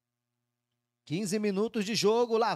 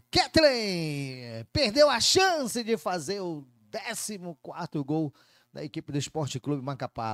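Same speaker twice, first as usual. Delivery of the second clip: usual speaking voice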